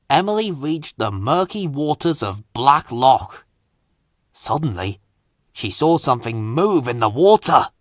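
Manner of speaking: ordinary delivery, nothing marked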